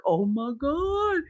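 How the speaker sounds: slow, high "oh my God"; retold horror, drawn out and high; mock horrified reaction. Reacting once door closed.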